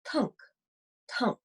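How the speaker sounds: plain reading voice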